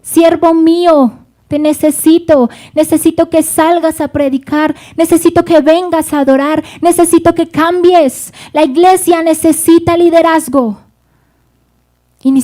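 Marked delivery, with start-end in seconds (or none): 0.0-10.8 loud, higher, urgent call to a servant; the Lord calling his servant, loud and pleading. Acted. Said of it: "el Señor te ha llamado".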